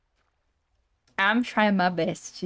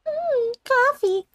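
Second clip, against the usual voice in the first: high savoring voice; slower than usual. Pretend. Savoring a coffee.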